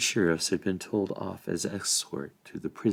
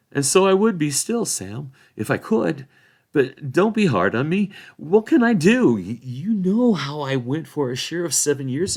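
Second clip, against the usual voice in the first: put-on high, loud, eager young hobbit pleading his case; a defensive hobbit begging not to be judged; pleading hobbit sheriff; 7 semitones higher, 6 dB louder than usual. Sheriff Robin pleads with Sam, high and loud.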